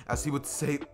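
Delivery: ordinary delivery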